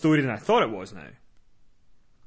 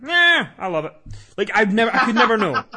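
higher, louder mock outburst; imitating Val's reaction; 5 semitones higher, 6 dB louder than usual. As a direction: toss it out high and loud, as a quote. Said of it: "randomly Val would be like".

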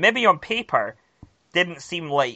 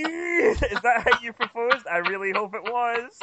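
laughing; 8 semitones higher, slower than usual. Real, not acted. Laughing after impression.

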